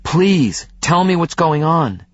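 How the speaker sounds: high, pleading and desperate; desperate plea; begging. A narrator's reading. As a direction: beg her to explain. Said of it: "I begged her".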